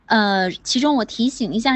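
normal talking voice

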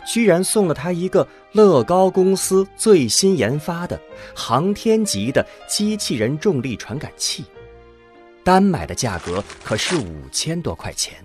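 ordinary delivery

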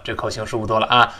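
ordinary talk, nothing marked

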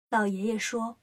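usual speaking voice

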